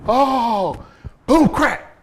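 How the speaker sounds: high, loud cry and crash noises; sudden loud "oh", then punched impact words; imitated fall and crash. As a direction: act out the fall with explosive sound effects. Acting out Batman's fall.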